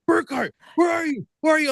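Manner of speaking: high, searching call across the arena. Retold or imitated. Jason searching for him in arena.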